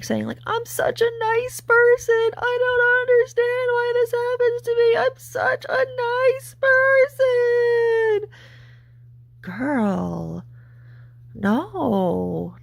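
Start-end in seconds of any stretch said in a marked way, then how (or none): 0.5-8.3 very high, wailing mock sob; mock crying. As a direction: imitate her crying, drawn out and piercingly high.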